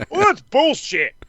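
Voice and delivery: slow, high put-on voice of Don; hammed-up Don impression; mock Don voice. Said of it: "Don's like".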